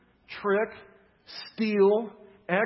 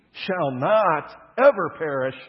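"shall not ever perish" slow and much louder, each word hammered; emphatic; 2 semitones higher, 10 dB louder, much slower than usual. Shown as a demonstration. Preacher giving the literal Greek to his congregation, emphatic.

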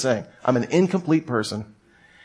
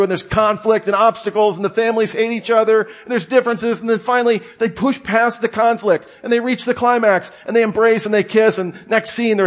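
building excitement; 8 semitones higher than usual. Real, not acted. Building movie plot summary.

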